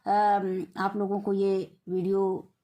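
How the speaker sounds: ordinary talk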